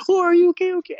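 mock alarm, as if catching a fall; concerned nurse. Nurse sees him run into the wall, high worried mock alarm.

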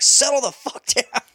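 imitated dad snap. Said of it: "I'm like".

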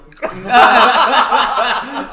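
high, loud burst of laughter; real laughter at the friend's joke. Real, not acted. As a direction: crack up.

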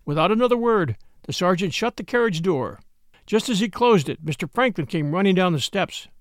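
ordinary delivery

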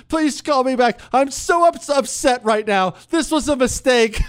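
high, whiny imitation of the upset wife; mock upset wife. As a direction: mimic the upset wife's plea. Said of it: "she said".

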